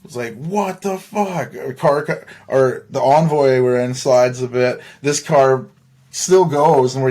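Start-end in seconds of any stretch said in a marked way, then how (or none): 0.4-1.5 slow, drawn-out stunned curse; shocked. Retold or imitated. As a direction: draw it out in disbelief. Sudden crash while driving.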